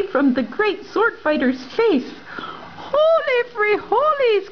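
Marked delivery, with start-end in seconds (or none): 2.9-4.5 excited cry. Acted. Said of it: "cried Skipito".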